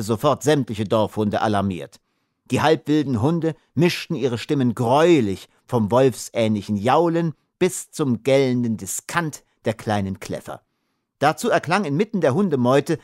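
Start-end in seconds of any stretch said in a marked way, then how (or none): none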